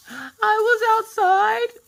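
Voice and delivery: very high, drawn-out mock whine; whiny child. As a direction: wail it slowly like a little kid. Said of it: "get her to calm down".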